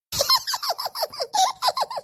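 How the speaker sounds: a high burst of laughter at the doctor; loud laugh. Acted. Pregnant woman laughing at the doctor's exam, high burst of laughter. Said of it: "बहुत ज्यादा ही हंसी आ रही है तुम्हें".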